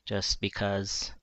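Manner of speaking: usual speaking voice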